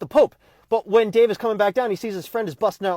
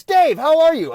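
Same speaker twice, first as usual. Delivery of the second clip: excited greeting; 7 semitones higher, 7 dB louder, slower than usual. Retold or imitated. Tom Cruise greets Dave at door.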